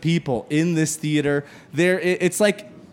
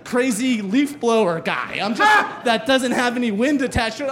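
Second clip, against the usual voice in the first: ordinary talk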